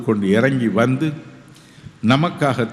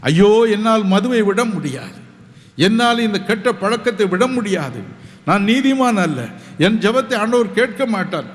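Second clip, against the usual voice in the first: despairing sinner; 6 semitones higher than usual. Retold or imitated. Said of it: "என்று சொல்லக்கூடாது".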